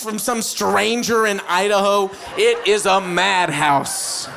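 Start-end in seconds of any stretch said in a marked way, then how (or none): none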